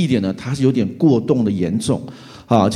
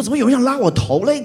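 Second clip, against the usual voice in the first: puzzled; 10 semitones higher than usual. Retold or imitated. Puzzled when someone pulls his hair.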